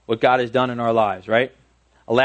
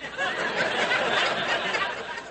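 soft breathy laugh; 7 dB quieter than usual. Real, not acted. Laughs at own story.

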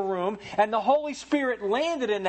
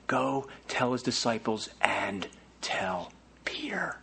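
slow, unvoiced, much softer whisper; tender whisper; 9 semitones lower, 6 dB quieter, slower than usual. God gently whispers the message meant for Peter. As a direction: whisper it like a secret of grace. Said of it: "And yet God says".

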